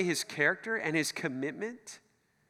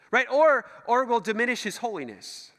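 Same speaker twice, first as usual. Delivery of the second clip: ordinary talk, nothing marked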